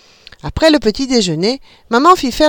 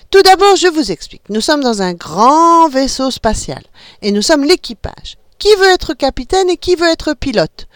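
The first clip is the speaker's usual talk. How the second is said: high, bright-eyed mother explaining a game; playful mother; 7 semitones higher, 3 dB louder than usual. A narrator's reading. Mother explaining the spaceship game to her kids, playful. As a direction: lead the children into make-believe.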